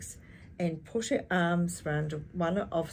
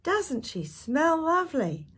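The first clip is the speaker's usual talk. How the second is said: put-on children's delight; 10 semitones higher, 4 dB louder than usual. A narrator's reading. Children admiring the horse.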